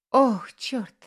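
sudden loud startled gasp of words; startled exclamation. Acted. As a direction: blurt it out in shock. Spills champagne on shirt.

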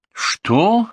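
high, rising "what?"; irritated query, pitch leaping up. A narrator's reading. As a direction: snap back a touch annoyed. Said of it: "раздражённо спросил я".

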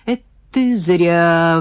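slow, drawn-out mild reproach; regretful, lingering warning; sighing regret. A narrator's reading. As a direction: sigh it out slowly. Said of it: "вздохнул Володя".

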